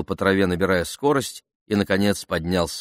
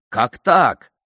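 short, high, loud shout; shouted cry; 5 semitones higher, 6 dB louder than usual. A narrator's reading. Cricketer appealing to the umpire, shouted cry. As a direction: call it out sharply from the crowd. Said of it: "Кто-то крикнул".

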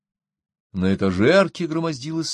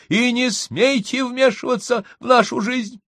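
raised, much higher voice through a closed door; shouting through the door. A narrator's reading. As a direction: yell it at the neighbour behind the door. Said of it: "сквозь дверь закричал".

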